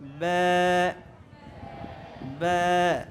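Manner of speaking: ব্যা with a light, fronted "ae" vowel, held for one alif; low, level, drawn-out ব্যা; thin ব্যা. Shown as a demonstration. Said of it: "এভাবে এক আলিফ টান দিব".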